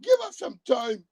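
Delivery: very high wailing scream; mock screaming. Mocking the woman begging Mister Trump, high screaming. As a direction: imitate a hysterical pleading screamer. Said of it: "She up here screaming".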